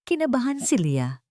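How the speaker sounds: ordinary delivery, nothing marked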